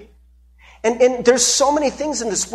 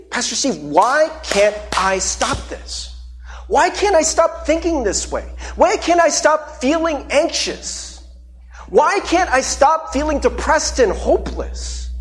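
higher, slow, pleading questions; a struggling believer begging the pastor for answers; desperate plea; 7 semitones higher, slower than usual. Acted. Voicing anxious congregants pleading.

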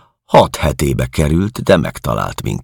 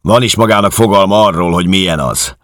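loud, higher, fast jeer; mouthing off; 7 semitones higher, 6 dB louder, faster than usual. Acted. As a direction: bark back mockingly. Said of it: "szájalt Georg".

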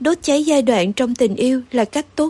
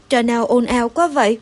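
ordinary delivery, nothing marked

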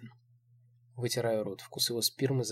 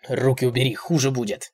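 loud, stern warning; threatening; 7 dB louder than usual. A narrator's reading. Old man warns her to obey, threatening. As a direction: warn her forcefully.

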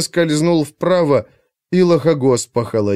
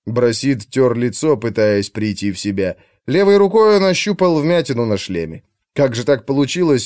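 ordinary delivery

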